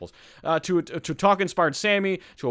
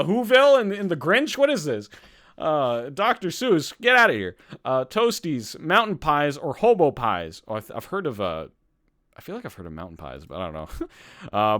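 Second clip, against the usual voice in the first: ordinary talk, nothing marked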